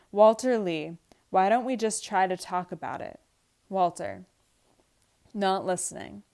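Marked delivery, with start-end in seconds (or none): none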